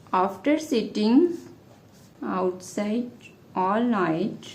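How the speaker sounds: slow dictation, pausing between phrases; dictating. Real, not acted. Teacher making an example sentence for students, dictating slowly. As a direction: hand out the sentence bit by bit.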